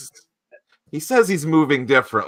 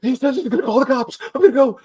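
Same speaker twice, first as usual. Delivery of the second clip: much higher, faster, louder mock panic; panicked fleeing; 7 dB louder, faster than usual. Visitor running from Ethan's warehouse, high mock panic. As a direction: hurry off as if scared of the cops. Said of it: "He's, like, running".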